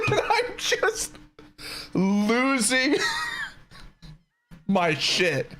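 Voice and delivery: real helpless laughter breaking through, drawn out; cracking up and barely getting the words out; laughing hard. Real, not acted. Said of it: "Trying not to laugh too hard".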